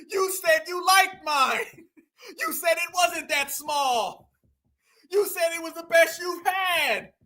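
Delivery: high, drawn-out jilted wail; mock hurt pleading. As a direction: plead slowly, hamming up the heartbreak. Mocking jilted man's upset pleading.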